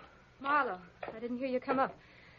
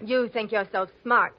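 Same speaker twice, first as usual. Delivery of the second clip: louder, spiteful jab; bitter retort; 12 dB louder than usual. Acted. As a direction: snap back at him. Angry retort to Marlowe.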